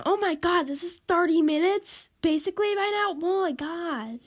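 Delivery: loud, high-pitched astonishment; real shock. Real, not acted. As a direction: burst out in disbelief at the time. Startled by the recording's length.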